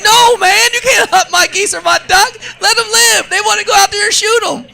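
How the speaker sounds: high, loud laughing protest; exasperated refusal. Retold or imitated. Host refusing to let in-laws shoot his geese, high loud protest. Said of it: "I'm like".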